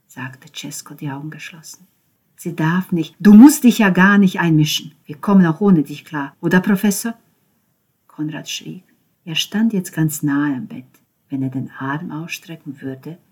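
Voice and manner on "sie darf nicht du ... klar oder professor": loud, hard and challenging; aggressive taunt. Acted. Ben taunting Konrad at the bed, loud and hard. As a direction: shove him with every word.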